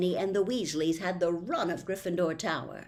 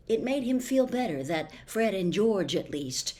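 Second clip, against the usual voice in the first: plain reading voice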